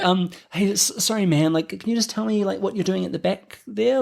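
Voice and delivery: high, hesitant, nervous request with a laugh; timid polite ask. Retold or imitated. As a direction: ask meekly, afraid to offend the barber. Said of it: "I was like".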